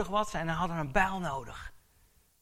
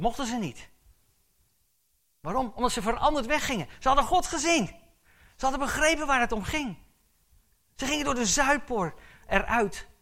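higher, louder preaching with rising fervour; fervent preaching; 9 semitones higher, 7 dB louder than usual. Acted. Preacher telling his congregation they left changed, fervent and loud. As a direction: preach it out, climbing with conviction.

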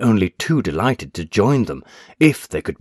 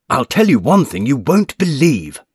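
raised, excited outburst; cried out; 7 semitones higher, 7 dB louder than usual. A narrator's reading. Patson cries it out to the doctor, raised and urgent. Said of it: "cried Mister Patson".

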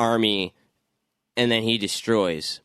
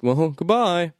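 higher mock villain farewell; mock goodbye; 6 semitones higher, slower than usual. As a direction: say farewell in a silly put-on voice.

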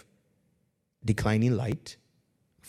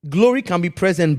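light Nigerian twang on the phrase; 5 semitones higher, 7 dB louder than usual. Shown as a demonstration. Pastor playfully repeats line in Nigerian accent. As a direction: lean into a Nigerian lilt. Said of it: "I feel like saying this with a Nigerian twang".

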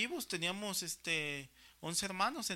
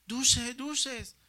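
lightly voiced little boy hawking candy, raised a bit; child calling out sweets; 4 semitones higher, 7 dB louder than usual. Little son on his tricycle selling paletas, calling out to buyers. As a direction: call out like a small kid selling treats. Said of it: "cinco años, decía".

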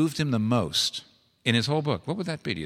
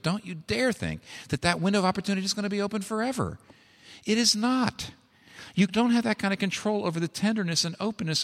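much higher, urgent pulpit warning; preaching at full force; 8 semitones higher than usual. Acted. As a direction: press the warning home hard. Urgent exhortation to the congregation.

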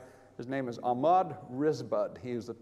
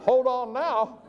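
shocked disbelief; 9 semitones higher, 4 dB louder than usual. Retold or imitated. Shocked inner reaction to pain.

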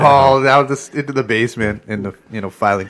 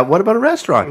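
suggested reply; 8 semitones higher, 4 dB louder than usual. Retold or imitated. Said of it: "You'd say".

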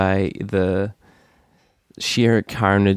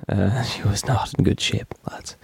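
laughing whisper; 4 dB quieter than usual. Real, not acted. Aside about Daenerys's state.

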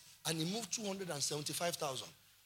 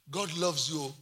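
normal talking voice

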